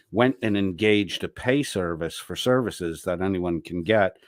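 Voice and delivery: ordinary talk, nothing marked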